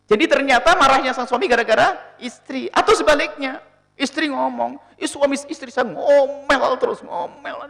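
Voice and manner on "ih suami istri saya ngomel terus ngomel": higher, slow, fading to a breathy end; mock whiny wife. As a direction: put on a wife's whine. Wife complaining about her husband. (istri mengeluh soal suami, suara tinggi dibuat-buat)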